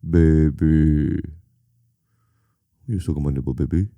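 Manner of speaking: low crooning seducer, half-joking; lazy deep croon for a laugh. Retold or imitated.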